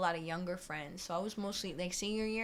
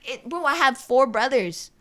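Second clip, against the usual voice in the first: high, loud, worked-up pleading; retold flustered excuse; 6 semitones higher, 10 dB louder than usual. Worker explaining herself to her manager, high pleading. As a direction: plead your case loudly, re-enacting it.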